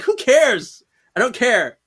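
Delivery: high, emphatic mock exasperation; dismissive. Waving the topic away, high-pitched exasperated dismissal. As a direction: toss it out in exaggerated impatience.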